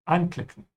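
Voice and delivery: anklicken with the an- prefix stressed. Shown as a demonstration. Teacher repeating the right stress for learners. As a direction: lean on the an in anklicken. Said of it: "the prefix should be emphasized".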